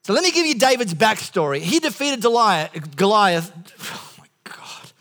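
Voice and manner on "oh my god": slow, voiceless aside; whispered exasperation. Real, not acted. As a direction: whisper it to yourself after a slip. Exasperated aside after fumbling a name.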